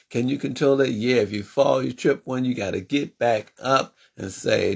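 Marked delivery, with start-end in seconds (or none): none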